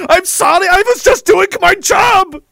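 high, loud, slow begging; pleading soldier. Acted. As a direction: plead desperately for your life.

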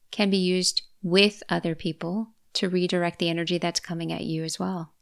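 usual speaking voice

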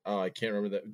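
normal talking voice

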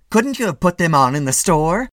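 high, loud reproach; scolding wife. A narrator's reading. Wife criticising husband over overshoes, scolding.

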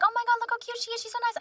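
high-pitched mock cooing; gushing dad. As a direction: imitate a dad melting over a puppy. Dad suddenly gushing over puppy.

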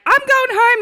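high, loud mock cry; mock labouring mother. Mimicking a TV birth show mother, loud high mock cry. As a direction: ham up a woman quitting labour. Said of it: "they're like".

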